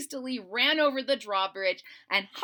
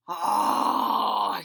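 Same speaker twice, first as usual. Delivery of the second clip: dragon sniffing; 7 dB louder than usual. Acted. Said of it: "he mumbled".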